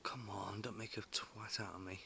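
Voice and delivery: hushed, breathy whisper to himself. Real, not acted. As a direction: whisper it so nothing is scared off. Whispering to orbs while filming.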